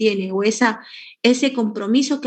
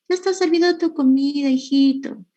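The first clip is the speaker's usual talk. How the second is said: a sweet, higher mother calling her son to eat; sweet motherly tone; 5 semitones higher than usual. Retold or imitated. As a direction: coax him sweetly to the table. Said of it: "con el mismo tono de voz todo dulce".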